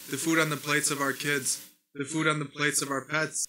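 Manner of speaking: ordinary delivery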